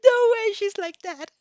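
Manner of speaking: delighted laughing outburst; amused disbelief. Real, not acted. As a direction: break character to gush. Reader's amused aside at text.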